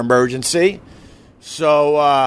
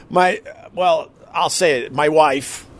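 usual speaking voice

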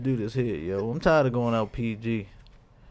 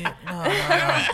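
high, slow, spaced-out voice; stoned drive-thru worker; 12 semitones higher, 4 dB louder, slower than usual. Retold or imitated. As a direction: play the baked cashier.

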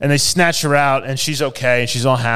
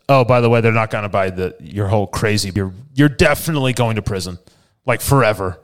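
sassy; 6 semitones lower than usual. Retold or imitated. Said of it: "with a little bit of sass".

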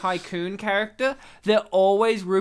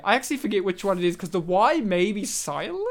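ordinary talk, nothing marked

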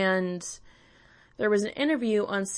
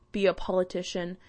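normal talking voice

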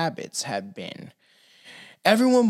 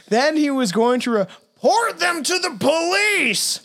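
ordinary delivery

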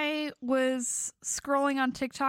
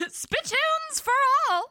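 put-on old-timey vendor; 9 semitones higher than usual. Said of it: "vendor bit".

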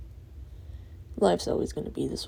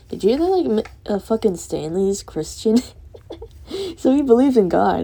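excited gossip; 5 semitones higher, 5 dB louder than usual. Real, not acted. Gossiping excitedly about Stanley.

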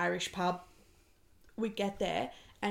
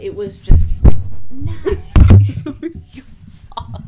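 sudden blast, much louder and higher; yelling into the mic; 5 semitones higher, 22 dB louder than usual. Real, not acted. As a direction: burst out shouting, then laugh it off. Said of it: "I'm so sorry if that hurt your ears".

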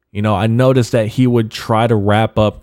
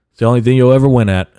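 loud fast jab; 4 semitones higher, 7 dB louder, much faster than usual. Real, not acted. Taunting critics who want his downfall.

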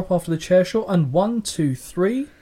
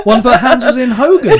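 louder, laughing through it; 7 semitones higher, 9 dB louder than usual. Real, not acted. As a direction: repeat it while cracking up. Laughing repeating her slip.

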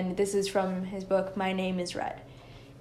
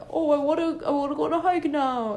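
higher, eager voice for the dog; mom doing dog voice; 6 semitones higher, 3 dB louder than usual. Retold or imitated. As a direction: mimic mom voicing the dog, a bit higher. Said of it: "her with my dog".

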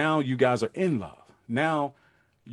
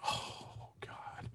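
unvoiced awed whisper; breathless whisper; 19 dB quieter than usual. Man gazing at his mate, whispering in awe. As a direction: whisper it, overwhelmed by her face. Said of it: "you're like".

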